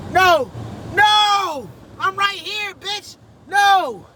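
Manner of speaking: very high, loud panicked yells at the other car; panicked yelling. Real, not acted. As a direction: shout in real panic. Car sliding toward another driver.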